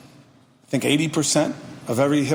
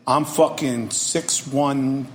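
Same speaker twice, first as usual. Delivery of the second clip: normal talking voice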